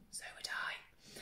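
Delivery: unvoiced whisper to the kids; whispered aside. Leader whispering a joke aside to the children, hushed. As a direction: let them in on a secret.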